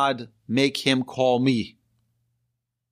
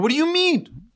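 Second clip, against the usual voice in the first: high, outraged "what do you mean"; indignant disbelief; 3 dB louder than usual. Retold or imitated. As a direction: squeak it in mock outrage. Indignant customer at DMV.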